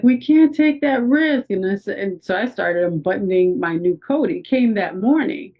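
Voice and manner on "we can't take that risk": high-pitched mimicked insistence; imitated insistent paramedic. Paramedic refusing to take the patient's word, high and insistent. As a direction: overrule the patient in a put-on high voice.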